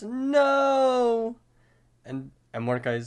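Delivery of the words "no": high, long drawn-out scream; hammed-up high scream; Rigby. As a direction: cry out in mock horror. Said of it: "Rigby screams".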